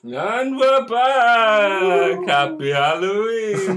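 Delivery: high, loud, stretched-out hype; excited shout. Acted. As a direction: shout it like a show host. Hyped return from segment.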